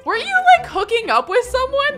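incredulous laughing question. Real, not acted. Teasing the neighbor about hooking up, laughing. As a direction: pitch shoots way up, half laughing.

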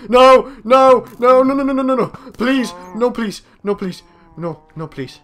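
loud frantic pleading, fading out; panicked begging. Real, not acted. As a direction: beg desperately for mercy. Monster attack, begging for life.

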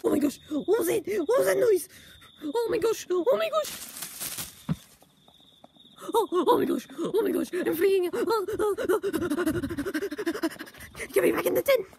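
high, loud, panicky yelps; panicked. Acted. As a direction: freak out at the noise in the dark. Luigi hears scary noise outside.

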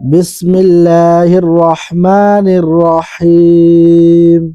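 slow, drawn-out Quranic chant; tilawat recitation. Shown as a demonstration. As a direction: recite it with tajwid, slowly. (ধীর তিলাওয়াতের সুরে)

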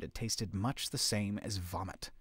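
usual speaking voice

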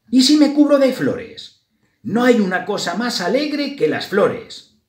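high, loud, bright-idea mouse voice; hopeful idea. A narrator's reading. Sad mouse Grisela thinks of covering herself in flowers, eagerly. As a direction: start high with sudden hope, easing a little.

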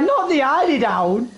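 mock horror over the eiderdown; mother's voice. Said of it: "She's like".

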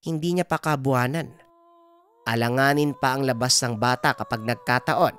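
lightly voiced grandmother giving firm orders; Lola Bilay, grave healer. Healer orders grandson and warns about the baby, grave. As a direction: take charge, then deliver the worrying news.

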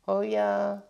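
flat unimpressed hamster; not buying it; unsurprised. A narrator's reading. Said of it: "didn't sound all that surprised".

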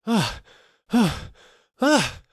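loud, very high gasping cries of terror; terrified cries. Acted. Fleeing merchant cries out at the soba seller's feet. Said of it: "crying out".

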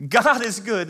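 high, loud proclamation; God is good, belted out; ringing affirmation to the room; preaching shout. Acted. Preacher repeats, much bigger.

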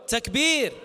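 crowd call. Real, not acted. Leading the crowd's takbir call.

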